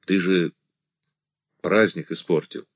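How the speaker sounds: plain reading voice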